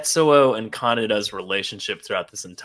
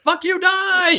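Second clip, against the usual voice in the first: high, hammed-up snarl of rage; mock angry yell; slower than usual. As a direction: spit it out as a mock yell, much higher. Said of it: "he's like".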